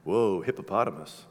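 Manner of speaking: low, quiet Adam voice marveling; voicing Adam. Speaker voicing Adam in awe, low and hushed. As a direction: drop deep and soft as awed Adam. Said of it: "And Adam's like".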